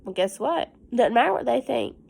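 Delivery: usual speaking voice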